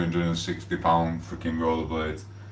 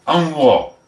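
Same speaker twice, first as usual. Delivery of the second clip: defiant shout; 8 semitones higher, 6 dB louder than usual. Real, not acted. Defiant shout after hyping.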